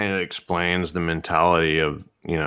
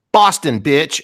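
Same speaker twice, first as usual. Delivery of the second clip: higher, louder Boston taunt; Boston accent taunt, shouted; mock Boston yell; 7 semitones higher, 6 dB louder, slower than usual. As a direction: yell it at the camera like a Rhode Island arrestee. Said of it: "she looks at the camera and she goes".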